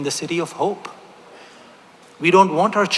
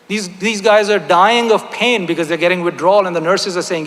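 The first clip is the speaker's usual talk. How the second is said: ordinary talk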